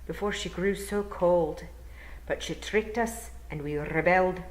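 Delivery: gentle Scottish burr, rolled r's; Scottish old Feagle. Acted. William explaining to Tiffany, courteous Scottish burr. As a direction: speak as a courteous Scottish elder.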